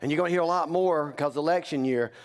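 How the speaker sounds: ordinary talk, nothing marked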